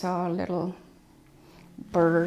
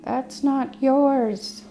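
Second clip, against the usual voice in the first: much higher, louder, slow stern scold; scolding the bears; 9 semitones higher, 6 dB louder, much slower than usual. Retold or imitated. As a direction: tell the bears off, firm and drawn out. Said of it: "And she kept saying".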